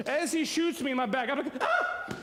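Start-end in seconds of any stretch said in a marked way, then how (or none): 1.6-2.1 put-on high shriek like a little girl; little girl scream. Hit in the back, he shrieks like a girl. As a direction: squeal in sudden pain like a little girl. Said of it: "I'm like a little girl".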